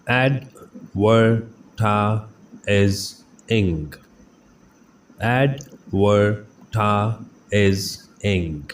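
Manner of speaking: Advertising stretched out very slowly; teacher slowly modelling the word for students; slow syllable by syllable. Shown as a demonstration. Slow, careful pronunciation drill. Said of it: "pronunciation drill".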